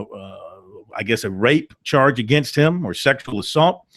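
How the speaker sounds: ordinary talk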